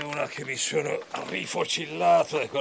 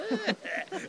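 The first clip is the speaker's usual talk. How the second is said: high mocking cackle. Artaud laughs at journalist.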